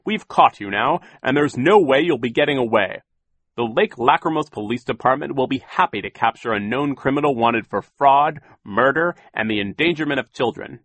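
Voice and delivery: loud, stern official; Mister Poe voice. A narrator's reading. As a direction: lay down the law. Poe lecturing.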